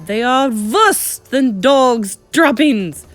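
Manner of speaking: slow, high, loud witch screech; high screeching tirade. A narrator's reading. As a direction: screech it with relish, word by word. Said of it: "screeched the Grand High Witch".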